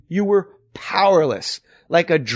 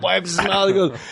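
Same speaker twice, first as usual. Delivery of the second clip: ordinary delivery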